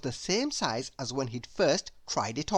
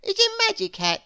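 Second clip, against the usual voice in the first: high, louder, secretive shopkeeper voice; a sly little hatter with a secret; 12 semitones higher, 6 dB louder than usual. Acted. Shopkeeper reveals magic hat.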